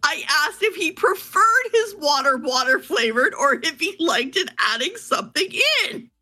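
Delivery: high, giggly protest through laughter; trying hard not to laugh; stifled giggles. Real, not acted. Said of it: "she's also trying not to laugh about it".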